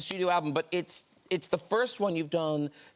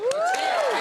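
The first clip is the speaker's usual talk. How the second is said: excited squeal; 4 dB louder than usual. Real, not acted. Host excitedly announcing album.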